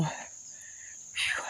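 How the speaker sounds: whispered winded puff; breathless exhale. Real, not acted. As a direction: blow out air, spent. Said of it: "I'll have to take my breath up here".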